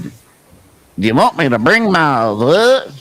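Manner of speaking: slow, lightly mimicked thick Southern accent; a hint of Curtis's thick accent; imitating a thick accent. Host retelling Curtis's phone question, a light thick accent. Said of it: "He had a really kind of a thick accent for me".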